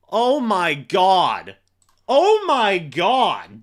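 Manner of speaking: can't believe the fourth-down conversion; real excitement. Real, not acted. Streamer reacting to a big play, excited disbelief. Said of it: "if I sound excitable right now".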